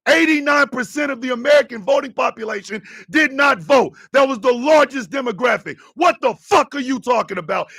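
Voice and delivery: furious rant at a commenter; real anger. Real, not acted. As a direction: let the fury out at Tony. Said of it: "angry, but I'm pissed".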